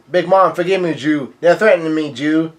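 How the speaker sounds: ordinary delivery, nothing marked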